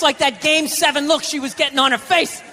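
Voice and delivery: normal talking voice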